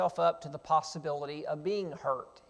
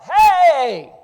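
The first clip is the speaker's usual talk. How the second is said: full-voiced, high, drawn-out holler; yelling out; 9 semitones higher, 16 dB louder than usual. [name] hollering from outside the camp, yelling out. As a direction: call out loudly across a distance. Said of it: "[name] yells out".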